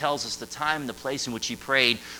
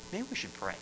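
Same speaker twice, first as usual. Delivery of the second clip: put-on softly, higher pitched, tentative teenager; gentle suggestion; 8 semitones higher, 11 dB quieter than usual. Teenager to frustrated youth pastor, a gentle suggestion. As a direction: quietly offer a timid suggestion.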